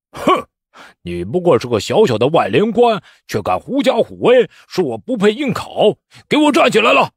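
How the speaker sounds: haughty dressing-down building to high shouted order; sneering. A narrator's reading. Candidate berating a petty official, sneering and domineering. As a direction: look down on him, then explode into a command. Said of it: "只听江大清冷笑一声".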